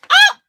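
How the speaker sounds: piercing high scream of excitement; shrieking scream. Real, not acted. Reader reacting to Princess's fight, shrieking scream. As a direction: let out a huge high shriek.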